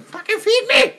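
very high-pitched comic pet voice; demanding little hamster. Retold or imitated. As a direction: squeal a cheeky demand, half laughing. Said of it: "I love the little hamsters, the kids going".

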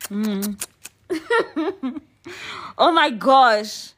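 loud, high, drawn-out swoon; flustered exclamation. Real, not acted. Host catching herself swooning on air, loudly flustered. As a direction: burst out, overcome and giddy.

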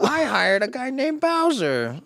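high, slow doofus voice; high goofy voice. Retold or imitated. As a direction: draw it out like a dim boss. Voicing the clueless presenter.

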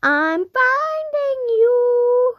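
high, drawn-out sing-song call; yelling across the room. Real, not acted. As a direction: stretch it out loud and high, slowly. Calling out during hide and seek.

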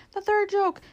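high, excited jackdaw squawking out words; great excitement. A narrator's reading. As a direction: pipe up high and loud, thrilled by the joke. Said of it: "said the jackdaw in great excitement".

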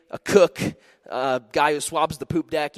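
usual speaking voice

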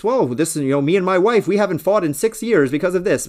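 mock a husband preaching his secret; smug married advisor. Mimicking relationship advice givers.

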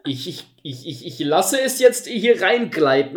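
high, slow, pompous hero voice; mock heroic knight. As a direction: ham up a grand heroic vow, loud and slow. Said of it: "Also, das ist so".

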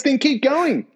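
high-pitched exasperated order; angry and angrier. Retold or imitated. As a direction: imitate a fed-up instructor. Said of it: "the staff's getting angry and angrier".

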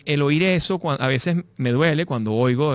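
ordinary talk, nothing marked

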